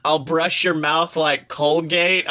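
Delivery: ordinary talk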